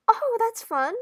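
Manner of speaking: high, loud cheery reaction; delighted. Acted. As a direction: pipe up with sudden enthusiasm. Flicker reacts brightly to palm reading.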